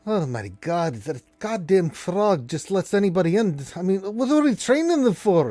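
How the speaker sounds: a grumbling dwarf getting worked up; annoyed dwarf. Acted. Dwarf complains about the frog, rising annoyance. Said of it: "And the dwarf's like".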